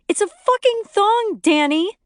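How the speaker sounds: very high, loud furious shriek; furious shout. A narrator's reading. Wife shrieking at her cheating husband. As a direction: scream it at him, outraged.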